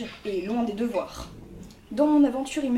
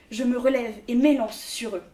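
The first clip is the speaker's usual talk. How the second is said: ordinary talk, nothing marked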